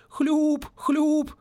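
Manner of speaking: high, rapid squelch sounds; hurried squelching. A narrator's reading. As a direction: tap out quick high squelches. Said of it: "торопливо застучал по воде".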